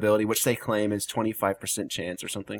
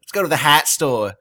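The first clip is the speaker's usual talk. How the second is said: put-on louder Boston accent, "hat store" with a dropped r; mock Boston accent; 4 semitones higher, 9 dB louder than usual. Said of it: "Is everyone like that?".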